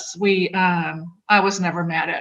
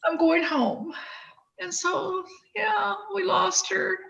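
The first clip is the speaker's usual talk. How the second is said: real tears, voice pitched much higher and choked; crying; 9 semitones higher than usual. Real, not acted. Reading her sister's suicide note aloud to Frank, crying openly. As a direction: read her sister's goodbye note through sobs. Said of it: "I didn't anticipate crying like this".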